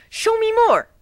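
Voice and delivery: thrilled by a new kind of magic; geeking out. Acted. Twilight geeking out over new magic, high and eager. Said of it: "geek out".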